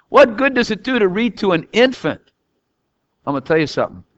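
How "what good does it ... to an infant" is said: mock doubting question, higher; a doubter's "what good does it do"; skeptical listener. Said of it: "You say".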